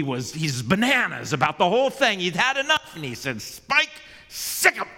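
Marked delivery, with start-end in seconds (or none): 3.4-4.9 higher, louder attack command; commanding shout. Acted. The angry bulldog owner orders Spike to attack, shouting. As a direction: yell the order at the dog.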